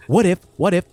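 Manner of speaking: normal talking voice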